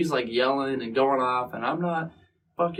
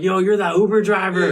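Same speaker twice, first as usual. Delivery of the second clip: higher, louder, laughing recognition; imitating mall strangers thrilled to spot him; excited fans; 6 semitones higher, 6 dB louder than usual. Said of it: "They're like".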